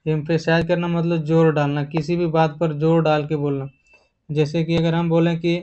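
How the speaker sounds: ordinary talk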